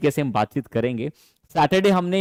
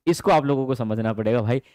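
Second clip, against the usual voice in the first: ordinary delivery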